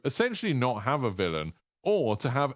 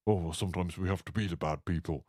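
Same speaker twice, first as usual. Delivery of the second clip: lower, softer old posh man, a failed attempt; old posh Price; 5 semitones lower, 5 dB quieter, faster than usual. A lightly put-on old posh man voice. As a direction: admits he can't do the voice, trying old posh Price anyway. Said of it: "I know that's not his voice".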